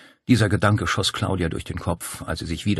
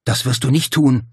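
loud, higher protest shout; acted shout; 5 semitones higher, 7 dB louder than usual. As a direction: yell to stop her. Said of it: "schrie Paul".

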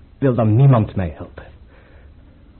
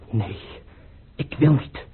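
ordinary talk, nothing marked

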